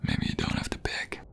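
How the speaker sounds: soft, close whisper to a lover; intimate whisper. Acted. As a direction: breathe the words right by their ear.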